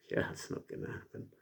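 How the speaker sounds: fast dismissive whisper; a fast, whispered, dismissive aside. Real, not acted. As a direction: brush it off under your breath.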